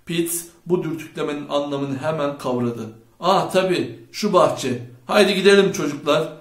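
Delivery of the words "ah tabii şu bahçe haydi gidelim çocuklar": loud, higher rallying call; raised voice of sudden agreement; shouting. A narrator's reading. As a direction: call the gang to go. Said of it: "diye bağırdı".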